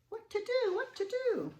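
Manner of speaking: high fretting voice; worried. Acted. Narrator fretting while Stanley watches the cavemen. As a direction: fret it high and anxious.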